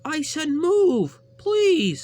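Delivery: very high, slow, loud yell; shouting move. A narrator's reading. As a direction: stretch the demand out loud. Percy repeats demand.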